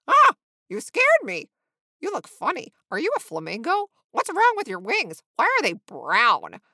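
loud, high, blurted questions; yelp, then pepper the stranger with questions; excitable and tactless young bird; startled flamingo. A narrator's reading.

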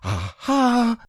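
a tiny pig trying to sound bold; Piglet's shaky aha. Acted. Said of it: "as well as he could after his terrifying journey".

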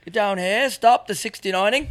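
high, loud British parent shouting; British mum voice. Pretend. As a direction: yell upstairs like a British mum.

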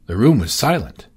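ordinary delivery

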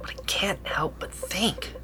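slow whispered thought. Acted. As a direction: breathe it out slowly to yourself. Thinking alone late at night.

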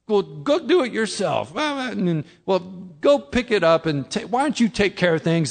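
a touch of grumpy snapping at the family; mock gruff order to the kids; grumpy gruff family man. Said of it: "grumpy and mad and you're gruff".

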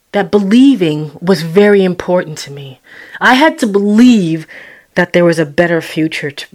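ordinary delivery

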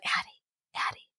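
put-on whispered warning to a child; hushed warning. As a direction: whisper the name as a discreet reprimand. Parent hushing rude child.

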